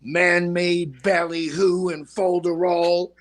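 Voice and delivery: Midwestern mother. Retold or imitated. Imitating her mother's Midwestern accent slowly. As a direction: drawl it out like a Midwestern mom. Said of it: "something my mother told me".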